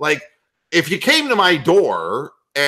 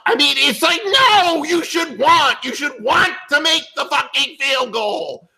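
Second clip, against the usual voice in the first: exasperated rant; 8 semitones higher than usual. Real, not acted. Arguing with the prayer, exasperated.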